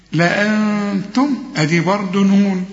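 normal talking voice

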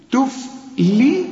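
تفلي broken slowly into "tuf... li"; light lam with kasra. Shown as a demonstration. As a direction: isolate the thin "li" in تفلي. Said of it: "مرققة ومكسورة". (تفلي بلام مرققة مكسورة)

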